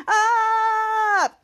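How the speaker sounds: long held high "ah"; retold yell while attacking; mock battle yell. Jeff yells while firing blaster.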